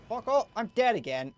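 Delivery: real rage at the game, loud; angry shout. Real, not acted. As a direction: yell it at the game after dying. Dies in game again.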